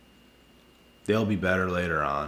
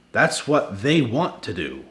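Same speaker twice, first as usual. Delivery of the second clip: ordinary delivery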